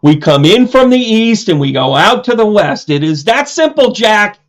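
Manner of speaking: pumped-up Keaton movie line; Mister Mom quote. Retold or imitated. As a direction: belt it like the film scene. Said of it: "Mister Mom's still one of my favorite".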